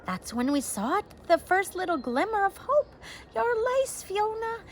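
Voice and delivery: high, rising joyful cry; father overjoyed at finding the lace. A narrator's reading. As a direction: call out the hope you found. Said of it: "their father cried".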